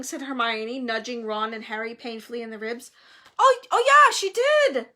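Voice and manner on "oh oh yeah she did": loud, high, overeager backing-up; hasty agreement. A narrator's reading. Ron and Harry covering for Hermione, overeager. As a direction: agree far too readily. Nudged friends hastily agree.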